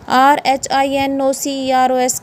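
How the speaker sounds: normal talking voice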